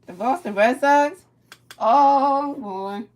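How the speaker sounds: can't believe what he's hearing; loud stunned question, then a high oh; surprised disbelief. Real, not acted. Reacting to Mariners losing to Red Sox.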